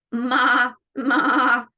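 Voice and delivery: wavering "maa" goat call; goat's bleat. Acted. Goat calls to the spider, quavering bleat. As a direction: bleat it with a quivering voice. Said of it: "said the goat".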